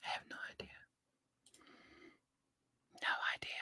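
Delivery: barely audible whisper; very soft, voiceless aside. Real, not acted. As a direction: whisper it to yourself. Muttering to self, unvoiced.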